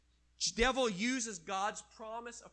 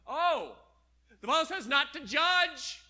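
higher, louder mock protest; mocking quote; 8 semitones higher, 9 dB louder than usual. Preacher mocks people quoting "judge not", loud mocking voice. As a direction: parody the excuse-maker's objection.

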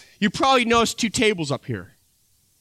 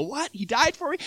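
pitched-up mock astonishment; a higher, startled double take; amazed disbelief; 8 semitones higher, 4 dB quieter than usual. Recalling first hearing the gospel, higher amazed disbelief.

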